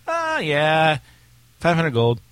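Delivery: lightly put-on high, loud voice; imitated vendor. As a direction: toss it out like a casual price quote. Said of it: "They're gonna be like".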